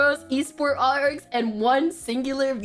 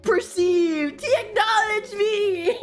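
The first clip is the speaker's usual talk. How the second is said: giddy; 10 semitones higher, slower than usual. Retold or imitated. Giddy at being noticed.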